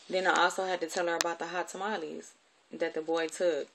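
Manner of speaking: usual speaking voice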